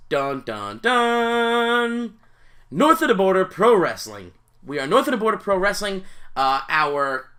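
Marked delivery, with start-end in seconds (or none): 0.1-2.2 rising dramatic fanfare; dramatic dun dun dun. Pretend. Dramatic reveal of the wrestling promotion. 2.7-6.0 grand announcement. Pretend.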